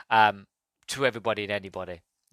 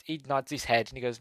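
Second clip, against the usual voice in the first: ordinary talk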